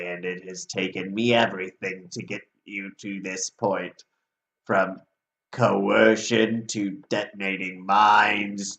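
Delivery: low, slow, menacing chain devil; manly devil voice. Acted. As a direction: pronounce their doom in a deep, deliberate devil voice. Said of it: "You have a very manly voice".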